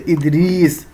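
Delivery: ادریس slowly stretched on the ee: "Idreees"; drawn-out long ee. Shown as a demonstration. Teacher demonstrating ya maroof, slow stretched ee in ادریس. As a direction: hold the ee in ادریس. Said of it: "جس کو کھینچ کے پڑھا جائے".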